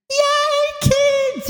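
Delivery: excited squeal. Real, not acted. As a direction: squeal it with delight, high. Delighted about kids on camp.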